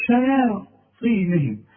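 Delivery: شياطينهم said slowly with a light shi-ya; slow recitation. Shown as a demonstration. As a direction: stretch out شياطينهم with a thin ya. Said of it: "هنقول شيا". (شياطينهم بترقيق الياء وببطء)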